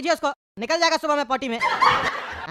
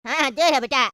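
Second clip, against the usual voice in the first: normal talking voice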